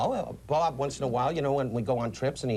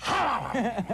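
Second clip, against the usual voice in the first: lion roar; 8 semitones higher than usual. Retold or imitated. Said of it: "And the lion goes".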